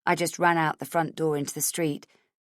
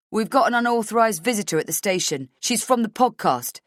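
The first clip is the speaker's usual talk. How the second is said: quick, higher report into the radio; guard radio report; 5 semitones higher, 4 dB louder than usual. Acted. Guard reporting intruder over radio, quick and raised. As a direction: rattle it off into the handset.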